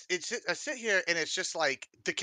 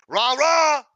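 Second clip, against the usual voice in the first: mock cheering; 7 semitones higher, 9 dB louder, much slower than usual. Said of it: "they're just like".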